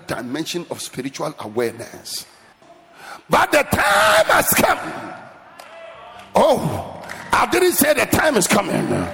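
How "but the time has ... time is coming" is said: loud, driving shouted sermon; fired-up preacher in full cry; full-force preaching. Real, not acted. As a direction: hammer each short phrase at the congregation.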